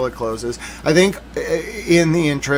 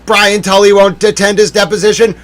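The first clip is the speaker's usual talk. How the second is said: raised, mildly mock yell; imitated yelling; 5 semitones higher, 8 dB louder than usual. People in court over the skipped deposition, mock yelling. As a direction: call it out like an indignant crowd. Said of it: "I bet people yelled".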